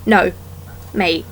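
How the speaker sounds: ordinary talk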